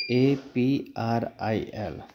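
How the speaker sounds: letters spelled out slowly, E P R I L; spelling a word out for a pronunciation lesson. Shown as a demonstration. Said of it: "इसको उस तरह से नहीं बोलना है".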